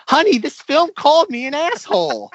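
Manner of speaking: high-pitched mock indignant complaint; Stephen King. Author griping to spouse about a film, mock outrage, high-pitched. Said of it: "Stephen King Shining comment".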